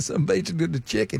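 Boomhauer-like Southern mumble. Retold or imitated. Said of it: "That's like Boomhauer".

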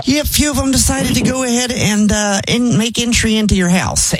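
high, apologetic tone; sheepish admission. Acted. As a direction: admit it a little embarrassed. Sheepish confession of goats.

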